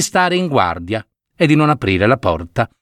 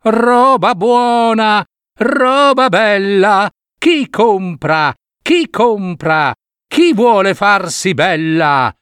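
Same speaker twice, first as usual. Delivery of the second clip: put-on old peddler crying wares; 7 semitones higher, much slower than usual. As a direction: call out to the whole street like an old peddler. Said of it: "e gridò". (la vecchia venditrice grida la merce, acuta e forte)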